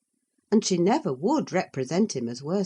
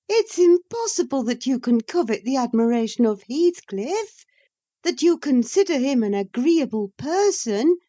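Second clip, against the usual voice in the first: high, louder and incredulous; scornful rebuke; 8 semitones higher, 5 dB louder than usual. Acted. Catherine scolding Isabella, high and disbelieving. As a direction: talk down to the foolish girl.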